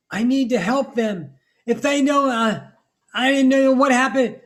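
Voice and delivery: loud, high-pitched mock pleading; hammed-up desperate shouting; frantic protest. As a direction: blurt it out in an exaggerated panic, high and very loud. Acting out a frantic, pleading person.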